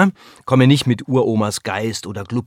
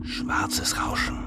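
whispering; 9 dB quieter, much slower than usual. Acted. Show title whispered ominously.

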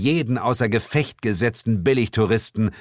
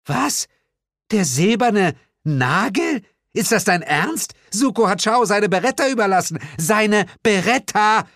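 indignant Glenda; 11 semitones higher than usual. Acted. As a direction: protest in disbelief, hitting Beretta hard. Glenda indignant at her weapon.

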